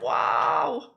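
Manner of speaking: usual speaking voice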